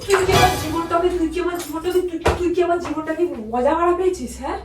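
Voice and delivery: loud, furious confrontation; enraged, distraught shouting; angry outburst. Real, not acted. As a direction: lash out loudly, repeating in outrage. Wife explodes when told to leave.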